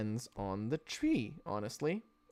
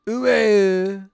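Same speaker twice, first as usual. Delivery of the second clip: mock French; 5 semitones higher, 14 dB louder, slower than usual.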